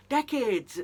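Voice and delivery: disbelieving exclamation; incredulous outburst. Real, not acted. Exclaiming at the show's logic.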